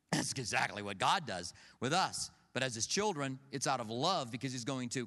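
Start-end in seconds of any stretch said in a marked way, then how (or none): none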